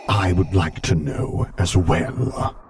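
slow menacing hiss; slow, restrained, dark voice; hissing whisper. A narrator's reading. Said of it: "he hissed quietly".

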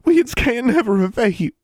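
put-on very high, loud, overwrought girl; weeping gratitude. A narrator's reading. Twin thanking her sister's rescuer, weeping. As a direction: pour out thanks through acted tears. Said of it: "Forbearance was weeping".

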